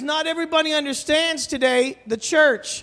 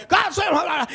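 higher shouted hallelujah; mock preacher shout; 7 semitones higher than usual. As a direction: scream it like the preacher. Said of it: "I'm hearing him screaming".

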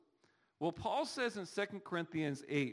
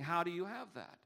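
usual speaking voice

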